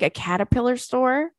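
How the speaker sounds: usual speaking voice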